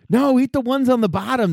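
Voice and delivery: high, insistent put-on voice; mock insistent urging. Berry eater telling friends which fruit to eat, mock insistence.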